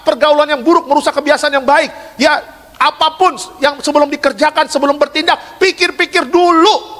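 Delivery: preaching at full force. Acted. Pastor warning young people about sin, fiery high preaching.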